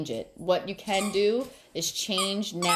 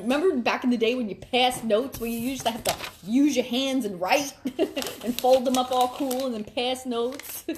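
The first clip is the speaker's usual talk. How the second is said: higher and louder, lit up by the memory; excited reminiscing; 6 semitones higher, 4 dB louder than usual. Real, not acted. To viewers, recalling folded school notes, excitedly higher and louder.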